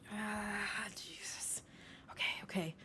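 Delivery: breathless whispered curse; hushed whisper. Acted. Captain cursing softly as enemies approach. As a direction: swear under your breath in alarm.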